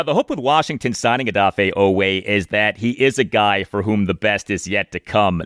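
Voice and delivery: ordinary talk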